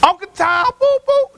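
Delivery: high mocking heckler voice, bleeping the insult. South Dallas heckler taunting the pastor, high mock jeer. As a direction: toss out the jeer in a high mocking voice, censoring with a boop. Heckler calls him names.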